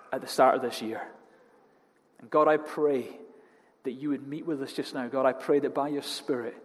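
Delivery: earnest, measured prayer voice over the congregation; opening prayer. Acted. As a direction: intimate church prayer. Pastor leads opening prayer.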